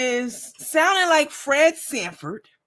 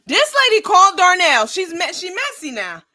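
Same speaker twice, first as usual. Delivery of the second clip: excited; 8 semitones higher, 7 dB louder than usual. Real, not acted. Excited loud gossip.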